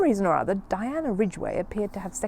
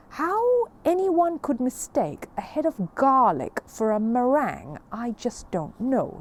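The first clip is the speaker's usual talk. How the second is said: exasperated father; 6 semitones higher, 5 dB louder, slower than usual. Acted. Father exasperated in smelly car.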